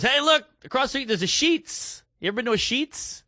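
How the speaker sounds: high, eager voice pointing across the street; excited Jesse. Retold or imitated. Jesse pointing out the Sheetz to his friend, high and eager. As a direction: sell the Sheetz like a big discovery.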